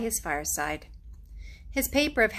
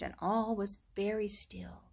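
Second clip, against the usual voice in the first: whisper; 7 dB quieter than usual. A narrator's reading. Hush falls in dark wood.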